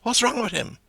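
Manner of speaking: high, falling Dublin query; McCoy's question. Acted. McCoy asking after Paddy, high falling tune. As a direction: ask it like McCoy in the pub. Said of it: "we had McCoy saying".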